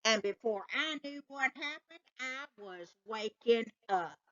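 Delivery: plain reading voice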